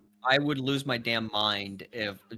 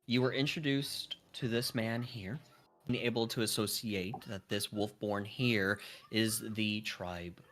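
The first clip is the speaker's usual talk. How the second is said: game master narration; 4 semitones lower than usual. Acted. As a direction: set the scene for the players, unhurried. DM narrates scene.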